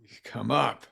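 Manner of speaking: normal talking voice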